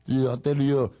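slow, lightly put-on Ontario Canadian accent; mock Canadian accent. Podcaster mocking how Ontario guys talk, slow Canadian accent. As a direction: drawl it out like a mocked small-town Ontario guy. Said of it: "a guy talking like".

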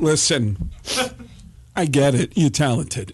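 halting impression with a long pause; Christopher Walken. Fed-up actor at dinner stops the impressionist, Christopher Walken impression. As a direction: imitate an actor who has had enough. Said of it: "until Walken finally just threw his".

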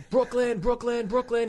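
high, chanted mock yell of Brooklyn. Retelling a comic's fight, chanting Brooklyn in a mock yell. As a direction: chant it up high like a brawler showing off. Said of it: "He's yelling all this stuff".

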